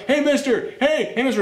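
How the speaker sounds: mock little girl voice calling mister. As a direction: play the eager kid calling the stranger.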